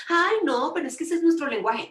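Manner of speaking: high, quick dismissive protest; imitated teen brush-off. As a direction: mimic youngsters laughing off a scolding. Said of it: "se ríen y se burlan".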